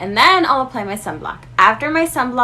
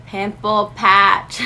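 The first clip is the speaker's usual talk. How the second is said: slow, louder, drawn-out pimple patch; drawn-out punchline; 5 dB louder, much slower than usual. Acted. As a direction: stretch "pimple patch" out slowly like a big reveal. Product punchline after pause.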